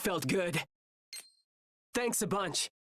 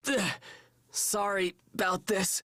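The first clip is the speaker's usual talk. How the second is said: normal talking voice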